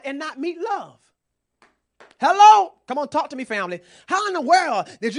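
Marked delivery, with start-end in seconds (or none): 2.2-2.8 high, loud preacher's hello; calling out to the church. Real, not acted. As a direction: shout to wake the crowd. Preacher calls to congregation.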